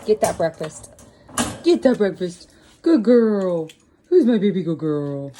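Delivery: loud doting pet talk; talking to a dog. Real, not acted. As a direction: coo over the pet at breakfast. Cooing to animals at breakfast.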